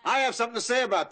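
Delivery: yelping to stop the family deciding for her. Acted. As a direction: cut everyone off at full volume. Interrupting the family's plans.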